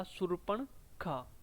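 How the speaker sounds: शूर्पणखा said slowly and softly; drawn-out शूर्पणखा; careful pronunciation. Shown as a demonstration. Teacher modelling शूर्पणखा slowly after the spelling rule. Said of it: "स आएगा इक्कीस वाला".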